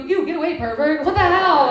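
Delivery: loud, high angry shout; startled yell. Acted. Comic as customer yelling at the lurking employee, shrill and loud.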